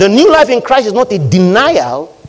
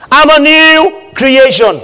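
loud, high, drawn-out declaration; ringing pulpit confession; bold proclamation; 8 semitones higher, 6 dB louder, slower than usual. Shown as a demonstration. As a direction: proclaim it slowly at full voice. Preacher proclaims the right confession.

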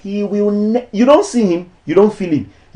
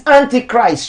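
ordinary delivery

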